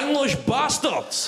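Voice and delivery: high, slow mock-furious outburst; outraged nationalist. Scottish nationalist cursing the booking line, mock outrage. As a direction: curse the machine, drawing the words out. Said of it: "I picture some Scottish nationalist".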